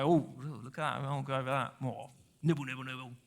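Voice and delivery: low, soft sheep musing to itself; quiet, absent-minded sheep voice; distracted grazing sheep. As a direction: murmur it low, absorbed in nibbling. Acts out a grazing, wandering sheep.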